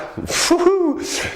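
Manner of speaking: a sudden high squeal of a laugh; high-pitched laugh. Real, not acted. Host cracking up at his own news story, high giggle.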